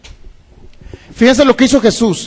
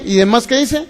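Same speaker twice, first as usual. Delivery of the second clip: normal talking voice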